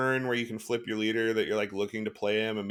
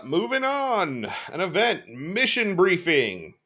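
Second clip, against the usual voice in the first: drawn-out announcer; 7 semitones higher, 3 dB louder, slower than usual. Real, not acted. Announcing next card slowly.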